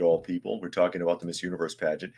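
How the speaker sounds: ordinary talk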